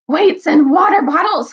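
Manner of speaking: high, loud comic oath; angry. A narrator's reading. Dwarf pinned under the boy, angry. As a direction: explode in exasperation. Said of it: "came an angry voice".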